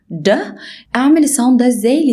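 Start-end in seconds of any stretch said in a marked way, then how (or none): 0.1-0.3 د with the tongue on the ridge behind the teeth; d sound. Shown as a demonstration. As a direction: tap د off the tooth ridge. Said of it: "عندي ساوند اسمه د".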